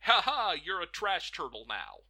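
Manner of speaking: loud gloating ha ha; villain gloating. Retold or imitated. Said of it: "just be like".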